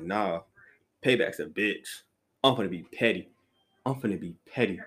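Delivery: low, unhurried self-talk; petty resolve. Retold or imitated. Speaker telling himself he'll get payback, low petty resolve.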